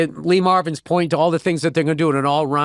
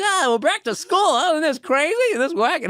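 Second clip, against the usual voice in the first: high, silly put-on voice; mock harmless comic; 11 semitones higher than usual. What students expected from the college comic, mocking high voice. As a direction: lampoon a cheesy campus comedian, pitched way up. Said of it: "get up there and be like".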